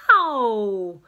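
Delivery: a loud yelp of pain; short hurt cry; mock pained ow. A narrator's reading. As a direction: yelp as you slip. Father slips on mustard.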